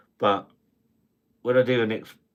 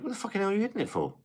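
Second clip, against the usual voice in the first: high, fast indignant squeal; mock outrage; 13 semitones higher, 3 dB quieter, much faster than usual. Retelling a row with his wife, hammed-up squeal. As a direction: play it as a hammed-up tantrum. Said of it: "I'm like".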